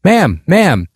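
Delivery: a hint of a stranger shouting; calling out. A narrator's reading. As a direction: call after her from a distance, understated. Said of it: "another man was shouting".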